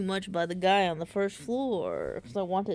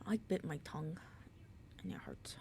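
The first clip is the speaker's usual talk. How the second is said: very soft, low whisper; pained whisper; 3 semitones lower, 11 dB quieter, faster than usual. Real, not acted. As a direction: whisper it through the sting. Just bit her tongue.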